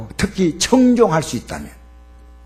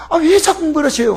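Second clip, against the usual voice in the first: annoyed retort; 11 semitones higher, 5 dB louder than usual. Retold or imitated. Child snapping at parent.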